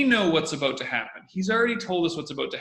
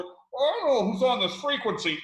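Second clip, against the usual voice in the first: a light, higher mock controller voice; imitating air traffic controller; 8 semitones higher, 3 dB louder than usual. Podcaster quoting the air traffic controller, lightly voiced. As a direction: quote the controller in a half-played voice. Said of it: "The air traffic controller".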